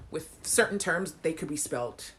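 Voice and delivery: ordinary talk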